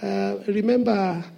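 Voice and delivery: slow, soft and tender plea to a father; unhurried, tender childlike ask; loving ask. Shown as a demonstration. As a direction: coax it gently, like a child asking Daddy. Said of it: "ask God rudely, lovingly".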